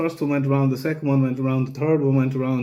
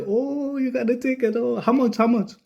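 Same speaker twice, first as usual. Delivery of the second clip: much higher, singsong amused mimicry; teasing girlfriend; 11 semitones higher than usual. Girlfriend amused at his ticket, high teasing mimic voice. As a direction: tease him like she's enjoying it. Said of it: "she's just smiling at me like".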